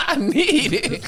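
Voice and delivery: very high, strained cartoon plea; SpongeBob desperate for water. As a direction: crack up into a desperate, thirsty squeal. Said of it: "trying to act like he didn't need water".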